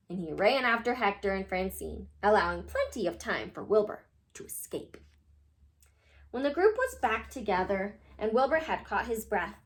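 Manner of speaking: ordinary delivery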